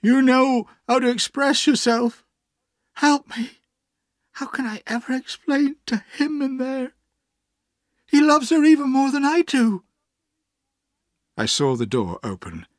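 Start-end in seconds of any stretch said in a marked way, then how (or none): 0.0-9.8 high, desperate pleading; wild sobbing. A narrator's reading. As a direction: beg for help in an anguished high voice. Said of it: "he sobbed wildly".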